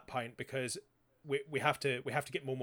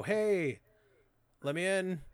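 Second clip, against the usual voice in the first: higher-pitched mock call to be let in; 5 semitones higher, slower than usual. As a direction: imitate calling out at the front door. Acted call to get let in.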